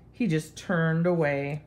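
ordinary delivery

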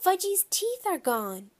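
put-on high, alarmed girl's voice; high-pitched cry without full volume; screaming. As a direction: wail it high in dismay. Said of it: "Shyla screamed".

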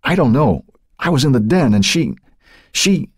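high, halting, shaken account; faltering. A narrator's reading. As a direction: break off on a repeated word. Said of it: "he faltered".

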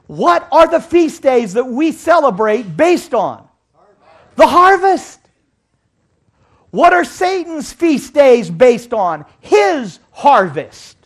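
loud, slow pulpit call; loud, slow preacher shout; preaching at full force. Acted. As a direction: drive the question home to the congregation. Preacher hammering harvest point.

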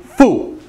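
FU high and loud, falling sharply; sharp drop on FU; fourth tone. Shown as a demonstration. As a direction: punch out a falling FU. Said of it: "fourth tone".

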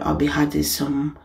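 ordinary talk

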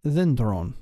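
slow "dendron" with the first syllable stressed; stress on penult. Shown as a demonstration. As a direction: say "dendron" slowly as DEN-dron. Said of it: "accented on their penult with an acute".

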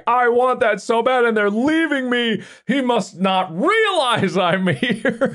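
high, pleading little lament; mock dog distress. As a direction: plead like a left-behind dog. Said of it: "she feels like".

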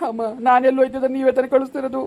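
high wail breaking into sobs; crying protest. Acted. Child telling mother it is they who send them, crying protest. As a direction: protest loudly through tears. Child weeping at being sent away.

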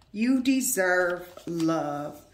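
slow, loud, deliberate card reading; reading the card aloud. Acted. Reader announcing the card's message to the viewer, slow and loud. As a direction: lean into each word of the card.

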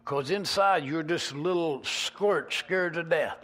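usual speaking voice

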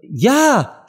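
high-pitched eager cry; shouted answer. A narrator's reading. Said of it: "schrie Alice". (gerufene Antwort)

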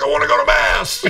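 high, loud, desperate cry; screaming. Retold or imitated. To the nun from his car, a high desperate scream. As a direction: yell it out like a frantic man. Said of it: "so I'm screaming".

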